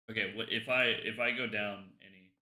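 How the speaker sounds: normal talking voice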